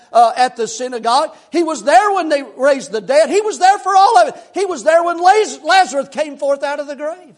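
loud, high, rising preacher's cadence building on each "there"; preaching at full force. Acted. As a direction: hammer every line like a revival preacher. Preaching climax on witness list.

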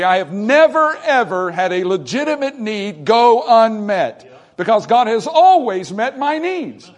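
loud, high preaching, slowing at the close; preaching at full force. Acted. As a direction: testify it to the rafters.

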